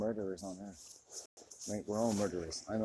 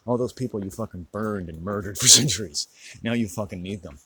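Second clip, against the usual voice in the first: louder, a bitter laugh on centuries; angry laughing rant; 8 dB louder than usual. Real, not acted. Vlogger raging at viewers, louder with a bitter laugh. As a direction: spit it out, then laugh.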